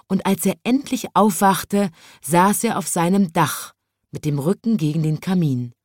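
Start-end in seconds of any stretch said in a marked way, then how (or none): none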